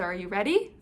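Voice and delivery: usual speaking voice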